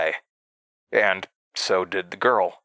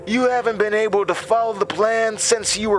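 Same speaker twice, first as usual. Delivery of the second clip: high mocking laugh and sneer; scornful; 13 semitones higher than usual. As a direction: ridicule her.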